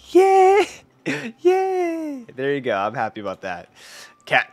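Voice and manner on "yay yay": excited cheering. Real, not acted. Clan jumps to top, cheering.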